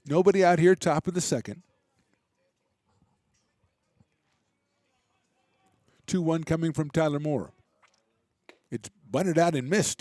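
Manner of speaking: brisk, lively baseball call; live play-by-play. Real, not acted. Radio announcer calling the inning for listeners, lively play-by-play. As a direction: keep the game moving for listeners.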